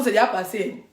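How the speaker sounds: normal talking voice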